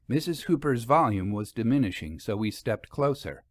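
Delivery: usual speaking voice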